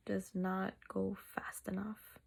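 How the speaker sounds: ordinary talk, nothing marked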